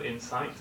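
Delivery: Incite said in-SITE with a soft rise; teacher models Incite quietly; model pronunciation. Shown as a demonstration. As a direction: say Incite stressing SITE, rising. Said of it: "Have you heard this word?".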